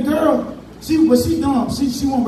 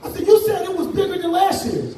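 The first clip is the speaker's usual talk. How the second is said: put-on a kid yelling betrayal up high; outraged kid protest; 9 semitones higher than usual.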